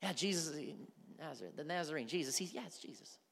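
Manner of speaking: very soft, low aside; hushed telling. Acted. Crowd telling the blind man who passes, hushed. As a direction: pass it on under your breath.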